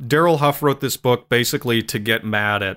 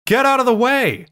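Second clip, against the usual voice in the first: high yelled get out of the way; frantic high shout; shouted warning; 13 semitones higher, 3 dB louder than usual. Retold or imitated. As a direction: yell it as a sudden warning, pitch jumping way up. Yelling warning at dumb soldier.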